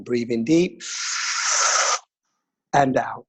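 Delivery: calm counting breath guide; slow soothing breathwork instructor; guided meditation. Acted.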